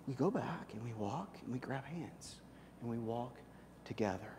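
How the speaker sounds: soft and tender, hand in hand; tender hush. A narrator's reading. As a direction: ease down to a near hush as you walk back. Tender return to Jesus.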